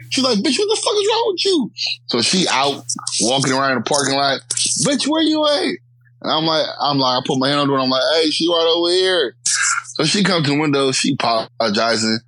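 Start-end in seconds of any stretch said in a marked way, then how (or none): 0.4-1.7 extremely high mock scolding; scolding homegirl. Homegirl telling off her friend, scolding falsetto. 4.9-5.8 high falsetto imitation, calling out; searching homegirl. Homegirl searching the parking lot, high-pitched calling. 8.2-9.4 calling out. Retold or imitated. Calls out to homegirl across lot.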